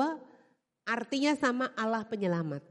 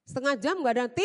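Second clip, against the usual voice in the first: ordinary talk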